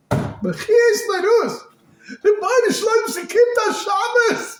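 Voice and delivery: high, loud drawn-out wail; impassioned tearful cry; crying plea. Acted. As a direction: cry it out slowly, pleading at full voice. Acting out a crying plea for Shabbos.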